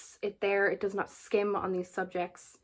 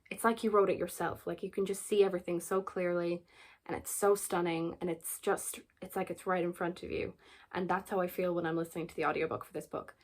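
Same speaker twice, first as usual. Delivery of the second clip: ordinary delivery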